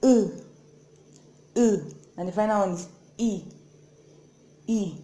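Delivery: "E" said with teeth closed; vowel E with teeth together. Shown as a demonstration. Teacher showing "E" with closed teeth. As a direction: model "E" biting the teeth together. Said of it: "you are biting your teeth together".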